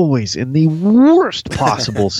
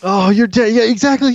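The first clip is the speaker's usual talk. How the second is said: excited agreement; 11 semitones higher than usual. Retold or imitated.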